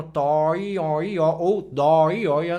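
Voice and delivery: connected "tó, i, ó" with small tongue movement; O plus I vowels. Shown as a demonstration. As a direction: glide "tó, i, ó" on a rounded O. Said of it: "vogal O mais vogal I".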